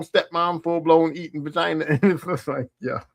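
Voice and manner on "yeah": ordinary delivery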